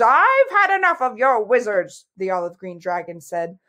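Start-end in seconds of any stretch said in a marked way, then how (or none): 0.0-2.1 higher, louder exasperated interruption; fed up. A narrator's reading. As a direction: cut in, out of patience. Fed-up dragon cuts princess off.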